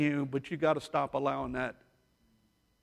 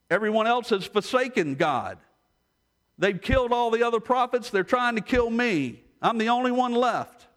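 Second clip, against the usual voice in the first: acted high-pitched complaint; venting; 6 semitones higher, 3 dB louder than usual. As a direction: pour out the grievance as Elijah, pitched up. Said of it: "Elijah vented a whole lot".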